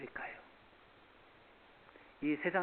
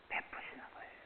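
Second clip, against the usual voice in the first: unvoiced whispered ending; 10 dB quieter than usual. Pastor whispering to the congregation. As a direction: breathe the words out.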